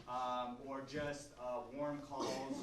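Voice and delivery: usual speaking voice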